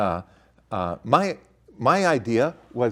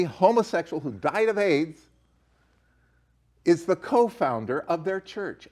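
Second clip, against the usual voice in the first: ordinary talk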